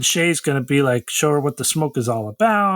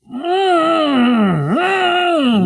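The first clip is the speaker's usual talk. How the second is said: mock grunt; 11 semitones higher, 5 dB louder than usual. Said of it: "Corey's like".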